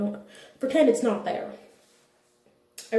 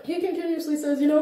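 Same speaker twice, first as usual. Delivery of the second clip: usual speaking voice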